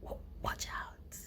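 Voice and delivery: soft unvoiced mock-menacing whisper. Real, not acted. Vlogger whispering a teasing warning to friends. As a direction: breathe it like a playful threat. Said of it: "playful threat to friends".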